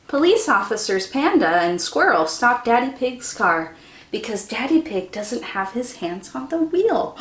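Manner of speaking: plain reading voice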